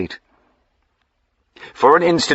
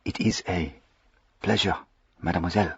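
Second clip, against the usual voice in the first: gasped out; 7 dB quieter, faster than usual. A narrator's reading. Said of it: "he managed to gasp".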